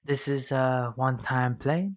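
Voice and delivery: normal talking voice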